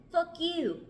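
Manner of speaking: angry retort in a fake English accent, pitched high; Mark. Mark spits back at a friend, angry bad English accent. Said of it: "Mark spat back".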